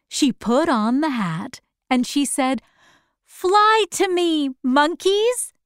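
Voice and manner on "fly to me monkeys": high-pitched summons; calling out. Acted. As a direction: call the monkeys to you, voice raised high. Dorothy summons the monkeys.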